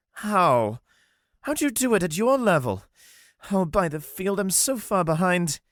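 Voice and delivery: dismayed Armand. Acted. Armand despairing over Wolf's progress. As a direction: lament being left behind.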